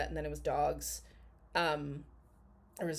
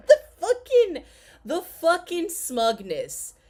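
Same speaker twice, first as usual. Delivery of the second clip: laughing high through the words; 10 semitones higher, 9 dB louder, slower than usual. Real, not acted. Reacting to the smug streamer, laughing hard. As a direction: crack up mid-rant.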